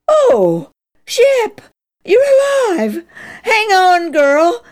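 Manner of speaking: high, loud burst of relief; joyful relief. Acted. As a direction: shout it as if hope came back. Boy finds the dog alive.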